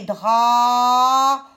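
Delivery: "drapeau" loud and slow, long "dra", long "peau"; syllable-by-syllable "drapeau"; split into syllables. Shown as a demonstration. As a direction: stretch both halves of "drapeau". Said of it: "je prends la deuxième syllabe".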